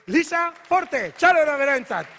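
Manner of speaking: loud and rising, whipping up applause; rousing call. Real, not acted. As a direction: call out to the crowd for a big hand. Host calls for warm applause.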